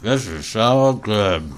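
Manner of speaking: talking with mouth full; words through a mouthful of bun. Real, not acted. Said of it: "Don't talk with your mouth full".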